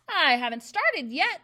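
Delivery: loud, slow, matter-of-fact threat; brisk menace. A narrator's reading. Trunchbull coolly threatens the squealing boy, loud and slow. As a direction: promise worse to come. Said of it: "the Trunchbull said briskly".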